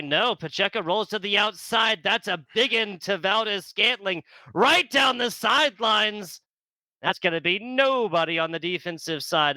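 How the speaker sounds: rising to a loud call on the big play; excited play call. Real, not acted. Big play called live.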